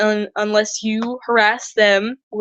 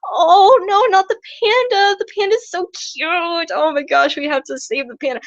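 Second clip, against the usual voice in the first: much higher, squealing mock gush over the panda; 8 semitones higher than usual. The public fretting over an endangered panda, high mock squeal. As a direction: squeal like someone heartbroken for a cute panda. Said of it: "they're going".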